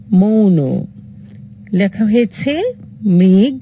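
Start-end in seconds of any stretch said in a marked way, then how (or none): 0.1-0.6 মৌন said with a clear closed o; মৌন pronounced clearly; closed o sound. Shown as a demonstration. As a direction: stress the o of মৌন. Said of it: "উচ্চারণ কি করব?".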